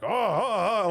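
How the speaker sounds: gruff barked syllables, hammed up; playful impression of harsh tones; mock angry Chinese. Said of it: "saying it in a way that makes him sound angry".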